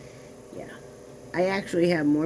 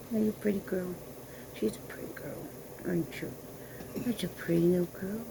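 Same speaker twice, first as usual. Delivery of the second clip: cooing at dog; 9 dB quieter than usual. Real, not acted. Owner cooing softly to her poodle on camera. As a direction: coo gently to a pet.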